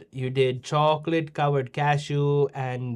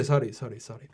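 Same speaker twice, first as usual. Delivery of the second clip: normal talking voice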